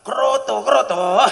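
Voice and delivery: high, loud calling out; bird-feed call. Pretend. Husband calling to wife about bird feed, loud and high. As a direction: call it out eagerly. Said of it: "ketemu istrinya langsung".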